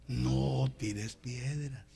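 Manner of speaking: very slow, softened, drawn-out words; calm restraint. Acted. Preacher restraining a provoked believer, slow calm warning. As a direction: hold them back slowly and quietly. Repeats warning calmly, second take.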